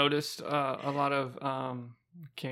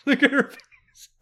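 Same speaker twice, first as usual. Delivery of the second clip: laughing; 5 dB louder than usual. Real, not acted. Watching funny clip, laughing.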